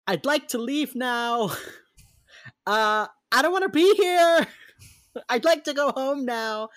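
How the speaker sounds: high, loud, put-on whine; mock-whiny plea; whiny inner voice. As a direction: whine it like a desperate kid begging to leave. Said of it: "in my brain, I'm like".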